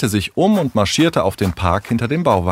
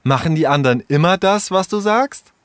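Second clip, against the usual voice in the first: higher, provocative questioning; challenging; 7 semitones higher than usual. Acted. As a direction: challenge him, voice climbing at the end. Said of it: "Herausfordernd starrte Sarah Tom". (herausfordernd, spitz nachgefragt)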